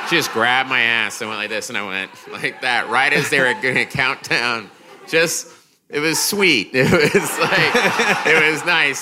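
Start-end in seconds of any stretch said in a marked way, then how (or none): 5.1-9.0 high, laughing words through giggles; can't stop laughing while telling it; squeaky laughter breaking through the words. Real, not acted.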